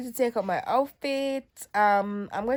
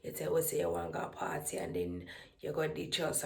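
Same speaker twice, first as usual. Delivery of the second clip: friends complaining; 13 semitones lower, 7 dB quieter than usual. Retold or imitated. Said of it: "My friends were calling me and say".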